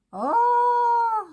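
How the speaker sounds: cri de surprise très aigu et fort; un oh éclatant, étonné; oh surpris. Real, not acted. Sa toile surprend par le résultat. (exclamation surprise, aiguë et forte)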